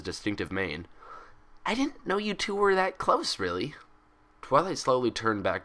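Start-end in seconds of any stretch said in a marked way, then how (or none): 1.6-3.8 put-on high, bouncy cheerful girl; bubbly Pinkie Pie voice. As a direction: chatter it out like an excitable pony.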